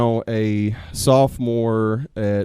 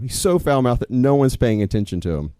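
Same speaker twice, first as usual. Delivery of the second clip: usual speaking voice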